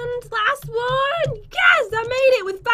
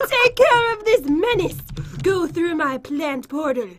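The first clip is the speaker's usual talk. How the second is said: princess voice; 7 semitones lower than usual. Pretend. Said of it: "can you do the princess voice?".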